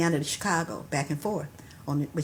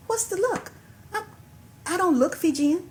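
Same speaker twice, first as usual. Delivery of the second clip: pitched way up in disbelief; incredulous; 10 semitones higher than usual. Retold or imitated. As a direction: act baffled at her answer, much higher. Incredulous retort to friend.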